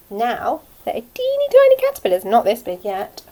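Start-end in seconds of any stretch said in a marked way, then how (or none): 0.1-2.2 high, bright squeal of delight; excited. Real, not acted. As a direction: squeal it out with a big lift. Excited showing tiny caterpillars to kids.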